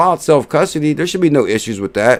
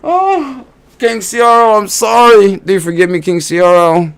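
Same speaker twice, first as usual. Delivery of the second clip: mock pleading; 8 semitones higher, 3 dB louder than usual. Begs token for forgiveness.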